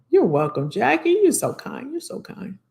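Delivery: ordinary delivery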